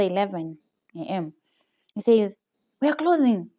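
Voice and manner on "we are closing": higher-pitched abrupt order; retold mad boss. Boss suddenly sending staff home, retold mad announcement. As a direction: announce it crossly, as the boss would. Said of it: "He's mad all of a sudden".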